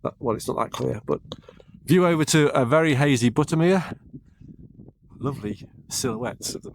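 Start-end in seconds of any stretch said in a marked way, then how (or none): 1.8-4.0 shouting over wind. Real, not acted. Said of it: "if you can hear me over the wind".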